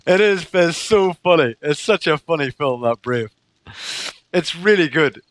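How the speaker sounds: normal talking voice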